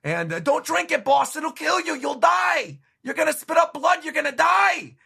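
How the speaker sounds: high, loud panicked pleading; panicked crew shouting. Acted. Bartolomeo's crew begging their boss not to drink, shouting. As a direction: yell at the boss to stop. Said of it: "pirates going".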